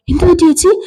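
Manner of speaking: high, rising worried question; lightly acted alarm, pitched well up; acted concern. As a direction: ask what's wrong in a high voice. Varsha asks what happened.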